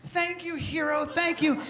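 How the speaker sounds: hammed-up townsfolk; grateful townsfolk. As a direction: gush like a thankful crowd. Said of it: "the whole town's like".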